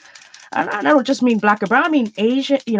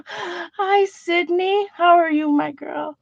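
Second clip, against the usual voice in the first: tender greeting; 6 semitones higher, much slower than usual. Real, not acted. Aunt greets her niece.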